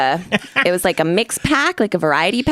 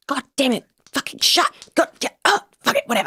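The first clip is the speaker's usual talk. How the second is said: frustrated muttering; 7 semitones higher, faster than usual. Retold or imitated. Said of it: "you hear, like".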